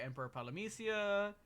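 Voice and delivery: ordinary talk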